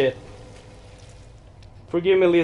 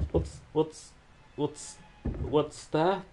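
much softer nervous half-whisper; quiet scared stammer; hushed; 3 semitones lower, 10 dB quieter, slower than usual. Real, not acted. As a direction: whisper it, afraid of what's there.

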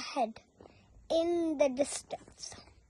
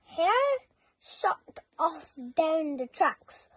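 usual speaking voice

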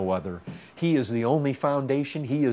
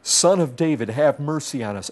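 louder, more insistent appeal for mercy; crying out more; 5 dB louder than usual. Retold or imitated. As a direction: raise the voice in a retold plea. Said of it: "they cried out all the more".

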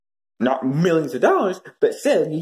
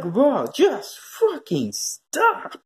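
drawn-out plea; 6 semitones higher, much slower than usual. Real, not acted. Drawn-out pleading stop.

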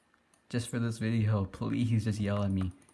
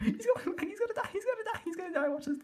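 very high squealing laugh-talk; helpless laughter; faster than usual. Real, not acted. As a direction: squeal the words through real laughter. Cracking up at game mishap.

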